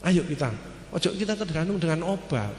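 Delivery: ordinary talk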